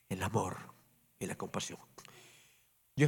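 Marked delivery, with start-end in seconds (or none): 0.1-1.8 whispered, barely voiced close. Real, not acted. Preacher to congregation about compassion, soft whisper. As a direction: breathe the words out, hushed.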